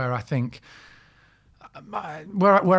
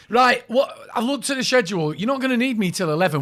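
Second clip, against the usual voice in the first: higher, louder lazy-actor complaining; whiny lazy actor; 6 semitones higher, 3 dB louder than usual. Retold or imitated. Mocking his cohost as a lazy actor, higher complaining whine. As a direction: moan about the schedule like a work-shy actor. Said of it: "you'd be going".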